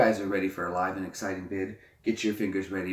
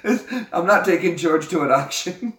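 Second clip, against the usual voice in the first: laughing through the words; can barely get it out for laughing; real giggling aside; 8 semitones higher, 8 dB louder than usual. Real, not acted. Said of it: "laughing through words".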